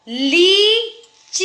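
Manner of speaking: "लीची" with long ली, pause, long ची; slow dictation of "लीची"; both vowels long. Shown as a demonstration. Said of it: "च में भी बड़ी आवाज़".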